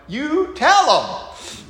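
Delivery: high, loud, slow egging-on cheer. Retold or imitated. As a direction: drag it out, cheering Paul on.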